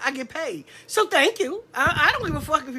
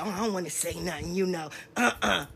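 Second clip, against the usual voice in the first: soft, lower and fast, backing off; shrinking keyboard thug, much softer; mock timid keyboard gangster; 8 semitones lower, 7 dB quieter than usual. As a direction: mumble like a coward dodging the question. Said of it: "keyboard gangsters, that will be quiet".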